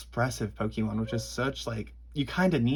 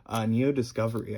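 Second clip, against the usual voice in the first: usual speaking voice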